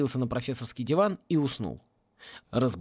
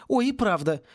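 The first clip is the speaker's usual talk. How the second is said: ordinary delivery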